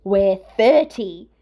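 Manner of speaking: loud, slow, insulted retort; an insulted man snapping back. A narrator's reading. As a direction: bite off each word. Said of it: "Shota snaps".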